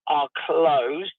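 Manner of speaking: usual speaking voice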